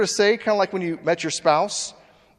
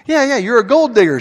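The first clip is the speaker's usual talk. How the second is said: loud taunting call, much louder and higher; mocking shout; 8 semitones higher, 11 dB louder than usual. Man shouts at the woman that she's a gold digger. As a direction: rub it in with a shout. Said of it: "And she goes".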